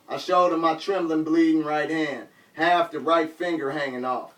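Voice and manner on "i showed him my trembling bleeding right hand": plain reading voice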